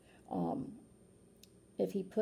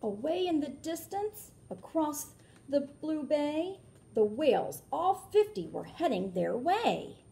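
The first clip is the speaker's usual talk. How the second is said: lilting read-aloud rhyme; storybook narration; 7 semitones higher, 7 dB louder, slower than usual. A narrator's reading. Reading a rhyming book aloud to children, lilting. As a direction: read it like a picture book for kids.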